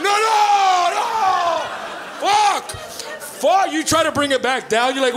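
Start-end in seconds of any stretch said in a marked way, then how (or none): 0.0-3.7 high frantic yell at the TV; panicked yelling. Retold or imitated. Viewer who missed his channel, yelling in panic. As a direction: yell as the channel flies past.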